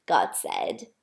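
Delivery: plain reading voice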